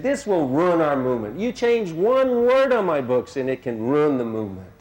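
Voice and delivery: high, loud, fiery warning; emphatic and heavy. Retold or imitated. Guru warning editors against changing his books, fiery and emphatic. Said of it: "he was so emphatic and heavy about this point".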